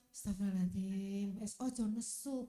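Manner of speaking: acted low inner voice of restraint; calming inner dialogue. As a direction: talk yourself down from anger. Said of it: "Ada dialog".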